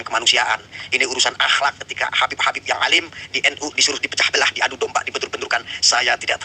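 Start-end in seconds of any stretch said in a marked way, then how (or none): none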